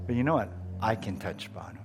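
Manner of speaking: put-on calm his scared girl down softly; reassuring dad. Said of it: "I reassured her".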